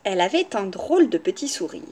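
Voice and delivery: ordinary delivery, nothing marked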